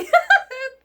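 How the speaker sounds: loud high burst of laughter; sharp laugh. Real, not acted. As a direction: laugh loudly. Laughing at her own point.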